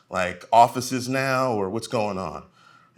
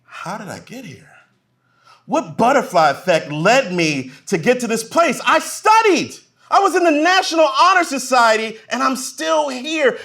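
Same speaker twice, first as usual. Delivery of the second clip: rising plea to himself; despairing office worker; 11 semitones higher, 5 dB louder than usual. Retold or imitated. Cubicle worker asking himself, building to high whine. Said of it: "you just wonder".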